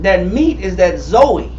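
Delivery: normal talking voice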